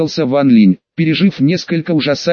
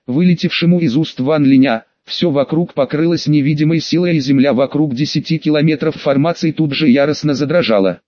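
plain reading voice

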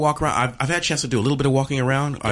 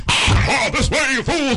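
high, loud wizard's cry; Gandalf; 13 semitones higher, 5 dB louder, slower than usual. Acted. Comedian mimics a film wizard rallying companions, high wizard's shout. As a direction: call out urgently as the wizard.

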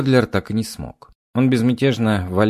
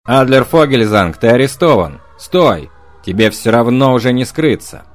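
booming officer's command; loud commanding voice; 3 semitones higher, 8 dB louder than usual. A narrator's reading. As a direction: bark orders at a fleeing man. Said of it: "Громкий командирский голос".

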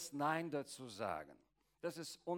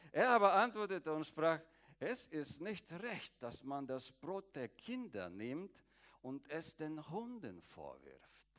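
scripture reading; 2 semitones higher, 3 dB louder than usual. Acted.

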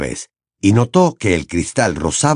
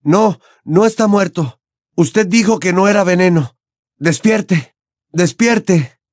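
frantic Harry; 9 semitones higher than usual. Acted. Harry shaking Dumbledore, desperate. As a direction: beg him to wake up.

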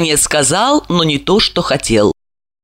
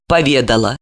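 plain reading voice

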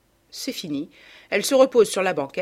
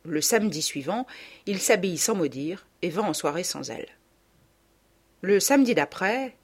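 ordinary delivery

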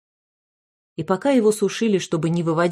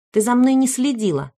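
declaring; 6 semitones higher than usual. A narrator's reading. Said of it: "заявила Полина".

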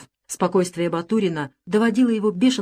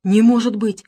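louder cry of disbelief; understated astonishment; stunned exclamation; 4 semitones higher, 7 dB louder than usual. A narrator's reading. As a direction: exclaim as if struck by the news. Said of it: "воскликнул поражённый Самсонов".

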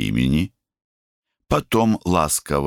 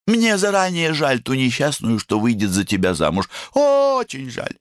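высокое протяжное «очень жаль»; тяни «очень жаль» высоко; девичья подколка; 12 semitones higher than usual. A narrator's reading. Сестра дразнит брата. (дразнящий девичий голосок)

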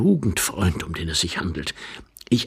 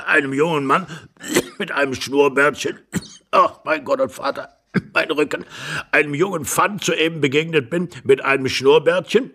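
put-on higher old man's voice, broken by coughs; rambling old husband; 5 semitones higher than usual. Old husband tells of a young man with moustache, coughing. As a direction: ramble on through coughing fits.

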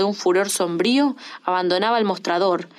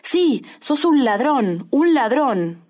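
furious shout; 5 semitones higher, much slower than usual. A narrator's reading. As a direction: yell it until the face goes red. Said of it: "gritó hasta que el rostro se le congestionó".

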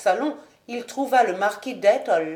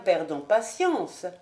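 ordinary delivery

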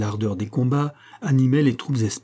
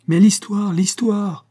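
high, louder insistent cry; impatient exclamation; 8 semitones higher, 5 dB louder, slower than usual. A narrator's reading. Choirmaster demanding the story from the doctor, impatient exclamation. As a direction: call out for the story, pitched well up. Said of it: "s'écria le maître de chapelle".

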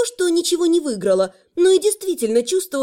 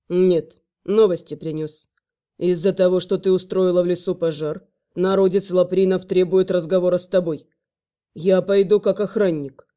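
low, measured male voice; low male dragon voice; 7 semitones lower than usual. Acted. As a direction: speak as a stern dragon guard. Dragon Velor speaks.

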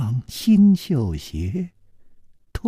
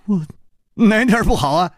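high, loud, rueful protest; acted exasperated question, pitched up; wry bitter smile; 9 semitones higher, 4 dB louder, faster than usual. A narrator's reading. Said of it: "楚留香苦笑着说".

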